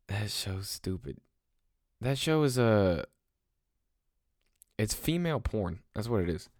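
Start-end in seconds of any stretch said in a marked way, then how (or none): 0.1-1.2 muttered verdict. Real, not acted.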